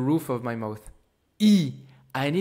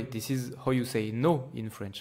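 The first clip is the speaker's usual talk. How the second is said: ordinary talk, nothing marked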